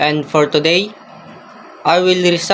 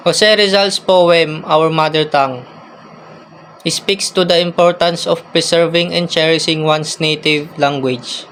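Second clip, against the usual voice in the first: usual speaking voice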